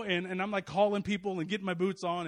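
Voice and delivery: ordinary talk, nothing marked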